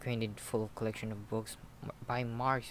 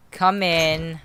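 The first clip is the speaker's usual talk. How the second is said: loud, raised, reluctant call; unwilling answer; 4 semitones higher, 14 dB louder than usual. A narrator's reading. Nurse calling to whoever knocked, loud and unwilling. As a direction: call out grudgingly toward the door. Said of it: "The nurse answered unwillingly".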